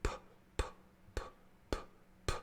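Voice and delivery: "P" said as a voiceless puff of air; repeated unvoiced p. Shown as a demonstration. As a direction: pop each p with no voice. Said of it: "start with the P".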